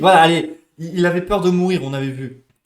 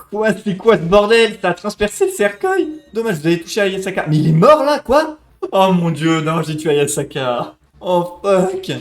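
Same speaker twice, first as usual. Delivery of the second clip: loud, stunned outburst; loud disbelief; 3 semitones higher, 6 dB louder than usual. Real, not acted. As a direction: shout in disbelief at the screen. Shock after killing character.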